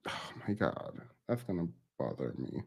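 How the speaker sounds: hushed, breathy aside to herself; under-breath mutter. Real, not acted. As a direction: mutter it almost silently.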